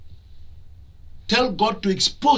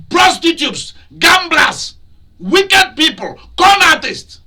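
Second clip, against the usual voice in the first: high, loud, scolding sermon delivery; fiery preacher rebuking the congregation; preaching at full force; 8 semitones higher, 5 dB louder than usual. Acted. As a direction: thunder it at them from the pulpit. Preacher listing sinners, rising.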